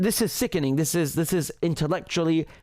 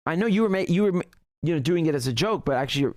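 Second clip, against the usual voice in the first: ordinary talk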